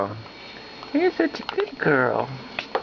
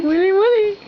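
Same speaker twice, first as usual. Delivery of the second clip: high playful coo, louder; 12 semitones higher, 4 dB louder than usual. Real, not acted. Dad coaxing the baby to eat, high sing-song.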